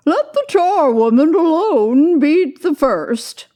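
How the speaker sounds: put-on high, loud cackling old charwoman; charwoman crying out. A narrator's reading. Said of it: "cried she who had entered first".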